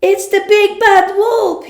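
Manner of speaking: high, loud alarmed cry; terrified cry. Acted. Piglet spotting the wolf, terrified high cry. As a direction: scream out the danger. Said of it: "he cried".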